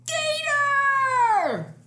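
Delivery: high, loud shriek of "Gator!"; frog's scream. Acted. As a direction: shriek it in panic like a tiny frog. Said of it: "And the frog screams".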